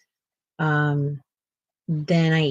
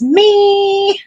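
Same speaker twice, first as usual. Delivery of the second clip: squeaky quote; 12 semitones higher, 9 dB louder than usual. Retold or imitated. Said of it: "Kathleen says".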